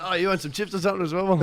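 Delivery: teasing through laughter; laughing. Retold or imitated. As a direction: laugh while offering. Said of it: "I was like".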